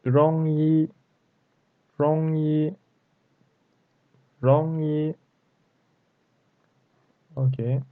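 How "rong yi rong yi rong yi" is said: "Rong" rising, "yi" held high and level; second then first tone. Shown as a demonstration. Teacher drills tones for learners, second then first tone. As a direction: climb on "Rong", hold "yi" steady up top. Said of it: "second tone and first tone".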